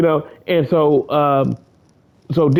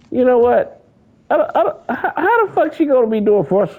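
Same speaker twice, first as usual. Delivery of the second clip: lightly raised scoffing voice; mock complaining fan; 10 semitones higher than usual. Fan mocking Daisy Ridley, high scoffing voice. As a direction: scoff at her like an annoyed fan. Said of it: "Even Daisy Ridley had to get said".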